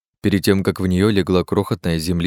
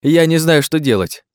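flustered helplessness; 8 semitones higher, 4 dB louder than usual. A narrator's reading. Helpless companion over the wounded man.

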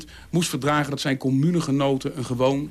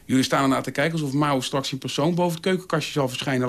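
plain reading voice

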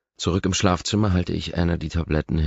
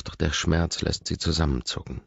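usual speaking voice

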